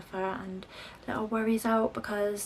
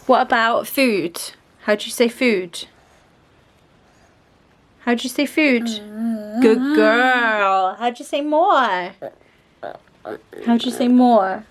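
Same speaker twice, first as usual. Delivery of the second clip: louder coaxing voice; 4 semitones higher, 8 dB louder than usual. Real, not acted. Mum coaxing her toddler to sign, encouraging. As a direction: prompt a little one to answer.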